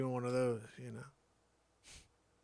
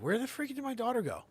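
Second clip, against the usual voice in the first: much higher, fast panicked search; fast high frantic dad; frantic high question; 14 semitones higher, 4 dB louder, faster than usual. Retold or imitated. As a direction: rush it out, alarmed. Dad searching for missing daughter.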